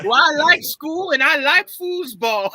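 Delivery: sing-song it like Bobby Boucher; hammed-up Waterboy voice; Waterboy impression. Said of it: "quoting Waterboy".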